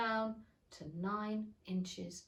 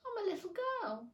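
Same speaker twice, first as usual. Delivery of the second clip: higher, hesitant claim; doubtfully; 10 semitones higher than usual. A narrator's reading. Alice answering the pigeon, doubtfully. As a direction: say it unsure of yourself.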